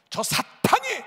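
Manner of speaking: loud, high cry of righteous fury; holy anger. Retold or imitated. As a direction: hurl the accusation at the enemy. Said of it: "사탄에 대한 거룩한 분노로 바뀌고".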